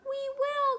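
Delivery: very high, loud squeaky cry; piercing little chorus; shrill chorus. A narrator's reading. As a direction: squeal it together like tiny mice. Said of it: "cried all the mice in a shrill chorus".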